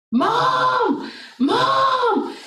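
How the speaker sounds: imitated high-pitched scream for Mom; long, high retold call; screaming son. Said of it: "my son is just screaming".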